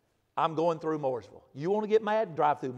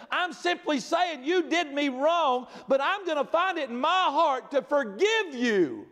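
raised, slow, emphatic preaching; full-force preaching; 7 semitones higher than usual. Acted. Preacher pressing the point on his congregation, emphatic. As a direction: drive each line home to the congregation.

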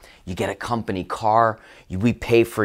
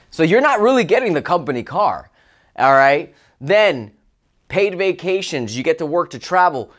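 usual speaking voice

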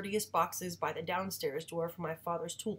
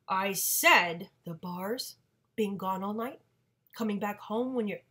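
loud, slow accusing mother; rising confrontation; 3 semitones higher, 8 dB louder than usual. Acted. Mother confronting father about the bars, loud. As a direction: lay out his sins, louder and slow.

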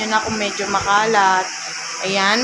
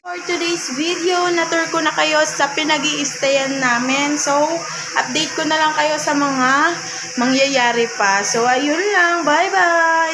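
higher, bright sign-off to viewers; vlog outro; 5 semitones higher than usual. Real, not acted. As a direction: wrap up the video cheerfully.

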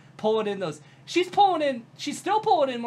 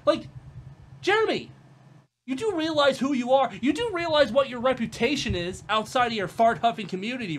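ordinary delivery

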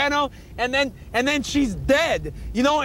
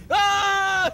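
high mock scream of falling; 7 semitones higher than usual. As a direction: yelp as you leap off. Axl jumping off the boat.

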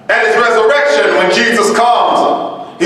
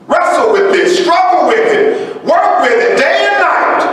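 preaching shout; 8 semitones higher than usual. Real, not acted. Preacher pleading with the congregation, rising shout. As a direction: cry out to the congregation to wrestle. Preacher urging hearers to struggle.

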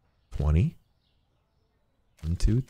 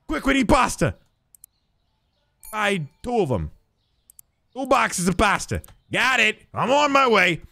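mock hurried shopper; 10 semitones higher than usual.

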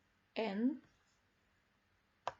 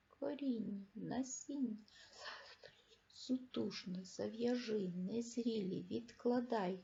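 the same words again, much softer and falling; soft repeat; 10 dB quieter than usual. Shown as a demonstration. Teacher repeats the word list to the class, much softer. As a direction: read the list back quietly.

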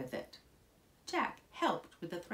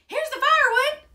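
high, loud, quick cry; excited shout; 14 dB louder, faster than usual. A narrator's reading. Jack announces the firewood to his mother, excited shout. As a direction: yell it fast and pitched way up. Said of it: "shouted Jack".

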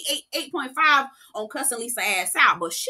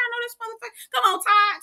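a high hushed I-know-him reaction; high mock gasp; 11 semitones higher than usual. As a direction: pop up high and hush it. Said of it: "I said".

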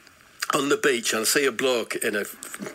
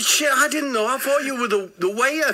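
panicked excuse; 12 semitones higher, 3 dB louder than usual. Retold or imitated. Acting himself apologising to attacker.

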